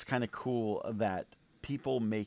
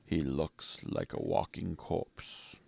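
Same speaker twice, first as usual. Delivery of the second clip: put-on lower, slow British villain accent; 6 semitones lower, 5 dB quieter, slower than usual. Reading the book's opening in a British villain accent. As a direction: read it in a slow, cold British accent like the bad guy. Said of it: "I give him a British accent because he's a bad guy".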